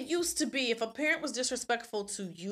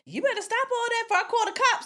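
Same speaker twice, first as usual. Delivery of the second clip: stern grandma; 11 semitones higher, faster than usual. Retold or imitated. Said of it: "my grandmother even scaring my little cousin saying".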